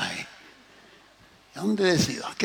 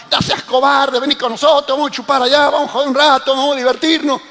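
high, insistent calling of rowdy friends; mock shouted urging; 7 semitones higher, 5 dB louder, faster than usual. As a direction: egg a friend on in a half-shouted stream. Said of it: "me grita ese asado". (imitando gritos insistentes de amigos)

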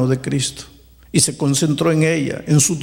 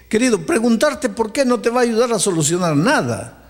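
ordinary talk, nothing marked

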